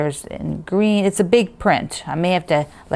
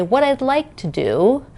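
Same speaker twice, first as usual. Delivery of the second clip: higher-pitched, lilting suggestion; positive spin; 8 semitones higher than usual. Shown as a demonstration. Vlogger coaching viewers to reword it, positive spin. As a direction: put a hopeful spin on it, pitched up. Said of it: "It's all how you say the words".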